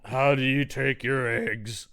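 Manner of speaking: slow put-on servant voice; henchman taking orders. Bruta asking Cynthia about breakfast, slow henchman voice. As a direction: plod through it word by word.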